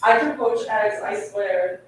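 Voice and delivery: normal talking voice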